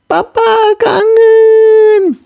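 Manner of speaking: high, very slow teary father voice. Acted. Father welcoming his daughter home, tearful. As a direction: let the longing crack the words.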